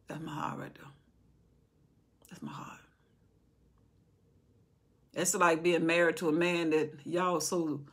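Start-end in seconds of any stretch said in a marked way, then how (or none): 0.1-2.8 softly voiced God, nearly a whisper; hushed voice of God. God speaking of David, said very softly. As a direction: hush it like a tender secret. God's words quoted softly.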